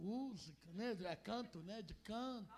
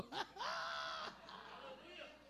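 laugh; 6 semitones higher, 4 dB quieter than usual. Real, not acted. Preacher laughs at own joke.

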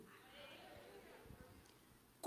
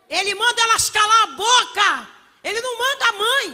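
shouted, higher sermon lines; loud preaching; 6 semitones higher, 14 dB louder than usual. Acted. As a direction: hammer the point home loudly. Preaching loudly.

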